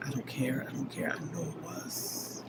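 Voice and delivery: breathy, fading whisper of devotion; intimate whisper. Retold or imitated. Telling the man she loves they were meant to be, whispered. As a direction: whisper it close, just for him.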